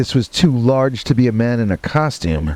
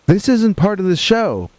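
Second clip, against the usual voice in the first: mock scream; 6 semitones higher than usual. Haunted house worker warning everyone while fleeing, mock screaming. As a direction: imitate a panicked yell at normal volume, pitched up. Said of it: "I was screaming, telling everyone".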